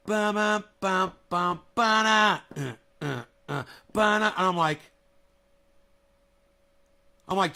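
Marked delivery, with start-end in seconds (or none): 0.1-4.3 slow scatted guitar intro; humming the riff. Retold or imitated. Imitating the song's intro, slow hum. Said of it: "And it's like".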